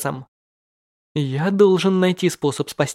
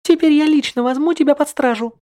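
put-on high, bright-tempered young woman; girl character voice; 11 semitones higher, 4 dB louder than usual. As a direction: arrest him in a high girlish voice. Girl character arrests hero. (высокий девичий голос)

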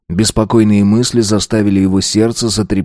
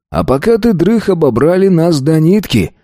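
much higher, indignant outburst; 7 semitones higher than usual. A narrator's reading. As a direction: blurt out the bad news. Sam tells robbery.

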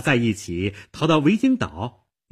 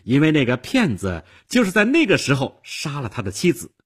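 heated accusation climbing higher and faster; angry exposé; 6 semitones higher, 3 dB louder than usual. Acted. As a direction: spill everything in rising outrage. Jilted woman furiously exposes lover.

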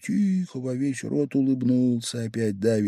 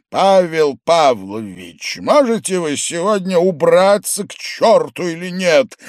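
loud, raised voice, starting slow and emphatic; angry demand; 7 semitones higher, 6 dB louder than usual. A narrator's reading. Host asking guest to get lost, angry and loud. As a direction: spell out the name, then snap.

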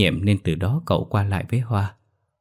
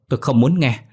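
raised, higher-pitched refusal; angry shout; 5 semitones higher, 4 dB louder than usual. A narrator's reading. Hoa refusing to hear the wife out, angry shout. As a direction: refuse loudly, temper rising. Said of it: "Hoa quát lớn".

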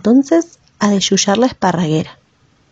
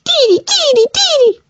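very high, fierce bird cry; angry bird squawk. Acted. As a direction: squawk back at her in anger. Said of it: "respondió también fuerte y enojada".

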